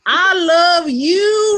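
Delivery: high, loud, slow hammed-up Texas drawl; stupid Texas accent. [name] wooing Miss Cartwright in a silly Texas accent. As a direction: ham up a dopey Texas accent, stretched slow. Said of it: "this stupidest Texas accent".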